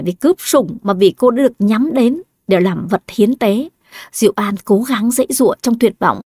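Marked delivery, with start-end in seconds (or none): none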